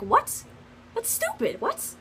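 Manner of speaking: mock the teacher's shocked what; teacher's disbelief. Teacher scoffing at the news, incredulous and high. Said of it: "she was even like".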